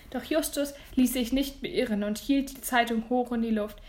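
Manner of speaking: plain reading voice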